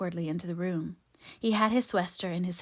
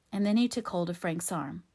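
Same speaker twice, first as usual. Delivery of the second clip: ordinary delivery, nothing marked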